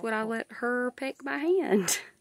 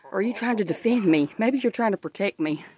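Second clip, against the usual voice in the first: normal talking voice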